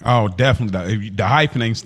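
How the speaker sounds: ordinary talk, nothing marked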